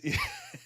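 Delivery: sudden squeaky burst of laughter; high-pitched laugh. Real, not acted. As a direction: let a high giggle escape. Laughing at his own joke.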